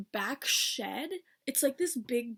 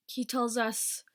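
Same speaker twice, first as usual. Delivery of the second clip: ordinary delivery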